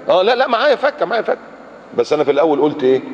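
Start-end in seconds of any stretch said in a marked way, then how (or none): 0.1-1.4 pitched up, quick correction; sudden admission. Retold or imitated. Man caught lying about change, suddenly admits it. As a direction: flip to yes, caught out.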